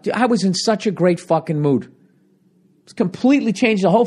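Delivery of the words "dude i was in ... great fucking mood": ordinary delivery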